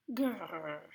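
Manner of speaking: soft rolled growl; contented bear rumble. Acted. Old Bear receives a hand-knitted scarf, soft growl.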